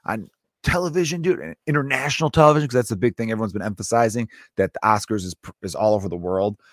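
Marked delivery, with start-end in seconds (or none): 0.1-1.4 higher-pitched but soft disbelief; half-voiced outrage, pitched up; mock indignation. Slapped comedian imagined making it bigger, mock indignant. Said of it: "could have made it a bigger thing".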